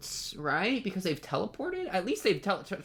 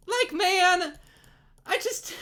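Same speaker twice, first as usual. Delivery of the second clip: exasperated squeal; 13 semitones higher, 4 dB louder, slower than usual. Real, not acted. Exasperated at scene.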